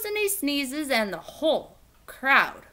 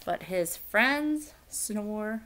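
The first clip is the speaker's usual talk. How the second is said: slow ending; 5 semitones lower, 6 dB quieter, slower than usual. A narrator's reading. Closing line slowed.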